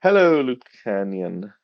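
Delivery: ordinary talk, nothing marked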